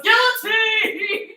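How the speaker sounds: mock scream, high and drawn out very slow; imitating a scream. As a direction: stretch the word way out like a rap scream. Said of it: "it goes, it goes".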